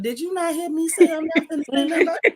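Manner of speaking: half-scolding while laughing. Real, not acted. Laughing mock scolding co-host.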